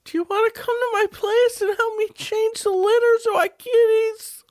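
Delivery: very high, tearful cat lady; squeaky old cat lady; crazy cat lady voice. Acted. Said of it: "I am a crazy cat lady".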